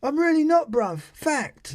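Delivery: mock denial. Vlogger mocking the accused man's denial, high and loud. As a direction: lampoon the denial in a silly high voice. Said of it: "That's what he says".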